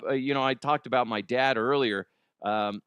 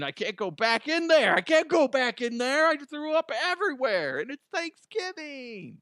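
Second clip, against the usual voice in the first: whiny mock excuse; 13 semitones higher than usual. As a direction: whine like a guilty boyfriend. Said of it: "Be like".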